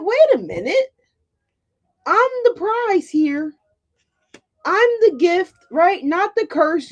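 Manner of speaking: high, excited wait a minute; sudden realization. Retold or imitated. Viewers voiced realizing their worth, high and excited. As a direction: voice jumping up as it dawns on them. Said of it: "You're like".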